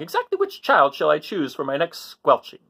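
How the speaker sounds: a touch of a gleeful witch; lightly voiced witch savouring the choice; witch musing. Witch picks her victim, lightly voiced glee. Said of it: "she says to herself".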